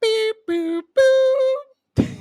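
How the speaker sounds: high robotic beeps; beep boop noises. Pretend. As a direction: chirp it like a robot. Sound effect joke.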